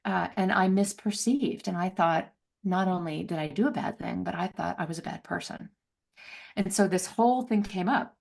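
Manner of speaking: ordinary delivery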